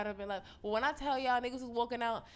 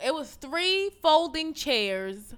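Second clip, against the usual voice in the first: loud, high disbelief; can't believe how cheap it was; incredulous; 5 semitones higher, 7 dB louder than usual. Real, not acted. Mocking the cheap panel.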